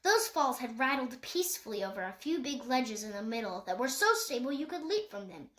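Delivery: ordinary delivery, nothing marked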